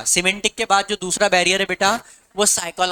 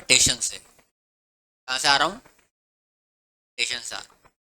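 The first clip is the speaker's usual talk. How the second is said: mock lazy student; 6 semitones lower than usual. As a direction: mimic a student mumbling his excuse. Mocking student's speech. (आलसी छात्र की नकल, धीमी और नीची आवाज़)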